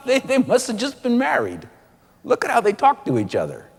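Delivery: laughing, charmed bystander; amused gushing aside; admiring onlooker. Acted. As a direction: chuckle through it like a delighted shopper. Supermarket onlookers admire the couple.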